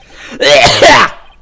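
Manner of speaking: big loud high cackle. Acted. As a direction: let rip a gleeful cackle. Witness character cackles.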